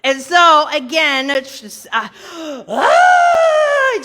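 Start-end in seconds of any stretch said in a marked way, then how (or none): 2.7-4.0 mock scared cry. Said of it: "just, like, freaking out".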